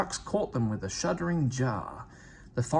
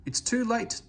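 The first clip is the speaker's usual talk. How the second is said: groaning despair; 10 semitones higher than usual. A narrator's reading. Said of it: "Duck groaned".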